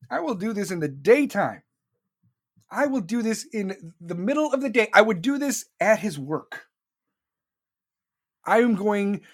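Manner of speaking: ordinary talk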